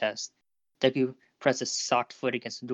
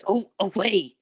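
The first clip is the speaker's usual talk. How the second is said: higher-pitched, urgent hiss; panicked hiss; 8 semitones higher, slower than usual. A narrator's reading. Boy keeping classmate away from his mother, panicked hiss. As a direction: push him off with rising panic. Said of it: "Izuku hissed as he began to panic".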